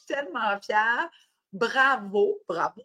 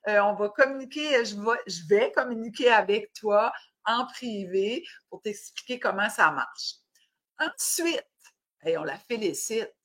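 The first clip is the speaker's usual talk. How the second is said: ordinary delivery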